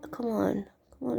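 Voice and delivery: normal talking voice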